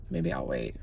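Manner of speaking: "Maybe I'll wait" whispered, no voice. Real, not acted. Vlogger hiding near the police raid, whispering. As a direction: breathe it so nobody hears.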